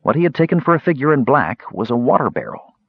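ordinary delivery

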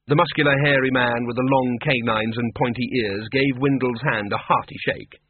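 usual speaking voice

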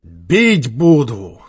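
slow, loud, drawn-out yell; shouted threat. A narrator's reading. Gang leader straightening up after defeat, shouted threat. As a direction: holler it back as a threat.

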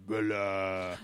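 deep, droning fake French accent, vowels dragged out; mock a voice-changed computer with a terrible French accent; Egypt, ridiculous French accent. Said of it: "a ridiculous French accent in this horrific, uh, voice changing, like, goes way deep".

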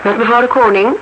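as if heard through a telephone; over the phone. Acted. (stem door de telefoon)

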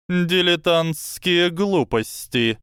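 high, slow serpent voice; a hissing snake, slow and sly. Acted. Snake voiced, sardonic laugh.

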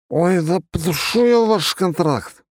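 put-on slow slurred drunk voice; drunk slurring. A narrator's reading. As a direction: slur it like a drunk lad. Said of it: "заплетающимся языком еле выговорил паренёк".

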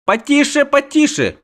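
very high, hasty protest; urgent shushing. A narrator's reading. Halfling hushes the innkeeper's alarm, high-pitched. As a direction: pipe up to hush him. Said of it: "кашлянул Дайнти Бибервельд".

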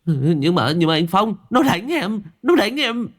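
high, whiny complaint; whining thug. Acted. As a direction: whimper like a tattling kid. Thug whines to his boss.

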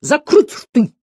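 high, forceful teacher's command; barked order. A narrator's reading. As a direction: order a rowdy class silent. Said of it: "гаркнул Слушкин".

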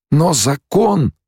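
slow, high-pitched objection; protesting follower. A narrator's reading. Stilgar reminding Paul of the law, protesting. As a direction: object high, leaving it hanging.

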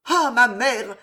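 high, loud outburst; heartfelt cry. A narrator's reading. Loïse vouching for the cavalier to her mother, loud eager cry. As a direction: burst out with all her heart. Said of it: "s'écria Loïse dans un élan".

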